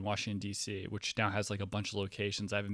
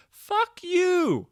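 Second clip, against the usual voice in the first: much higher, louder furious scream; angry yell; 11 dB louder than usual. Retold or imitated. Man screaming at the Uber driver who drove off, much higher, louder yell.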